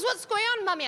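high-pitched imitation of a small daughter; curious child. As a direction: ask mother like a puzzled little kid. Acting out puzzled daughter.

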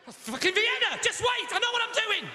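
high, loud frantic shouting; flustered outburst. Acted. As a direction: yell defensively, losing the plot.

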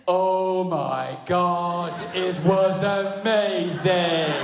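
loud, hugely drawn-out mock rapture; imitating wife's withering sarcastic praise. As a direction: stretch each word in fake amazement. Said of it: "the most sarcastic woman on the planet".

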